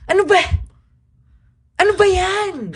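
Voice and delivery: high excited exclamation; excited cry. Retold or imitated. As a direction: cry out at the big play. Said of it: "I'm like".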